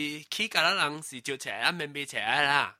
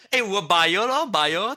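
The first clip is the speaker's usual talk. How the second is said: loud high yelp, then slow drawn bio; mock shock; 5 semitones higher, 6 dB louder, slower than usual. Real, not acted. Reacting to a friend's dirty joke, mock-shocked yelp. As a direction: fake gasp, then drag out bio.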